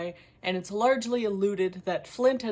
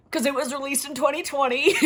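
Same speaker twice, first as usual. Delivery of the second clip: quick, giggly punchline; laughing; 4 semitones higher, 5 dB louder, faster than usual. Real, not acted. Reviewer explaining their hindsight joke about the book, laughing. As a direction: rush it out through a laugh.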